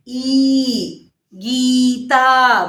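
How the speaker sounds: Gita drawn out very slowly, syllable by syllable; slow classroom enunciation; slow teaching demo. Shown as a demonstration. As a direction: spell out Gita slowly for children. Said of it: "बड़ी की मात्रा".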